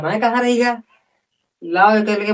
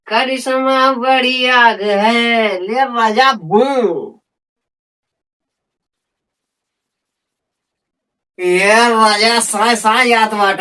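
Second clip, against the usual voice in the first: stoner; slower than usual. Acted. High after smoking bidi.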